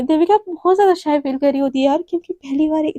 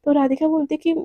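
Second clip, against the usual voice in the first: ordinary delivery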